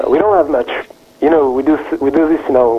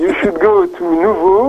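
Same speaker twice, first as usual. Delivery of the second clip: put-on higher, playful French accent; 5 semitones higher than usual. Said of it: "tell us in a French accent".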